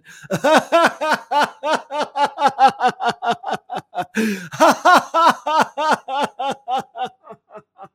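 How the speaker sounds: loud, high, sustained belly laugh; forced hearty laughter. Shown as a demonstration. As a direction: laugh on purpose, big and long. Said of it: "Here we go".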